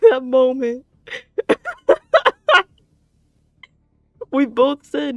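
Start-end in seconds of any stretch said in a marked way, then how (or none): 1.1-2.6 very high loud cackle; shrieking laugh. Real, not acted. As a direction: burst into a squealing laugh. Laughing at funny coincidence.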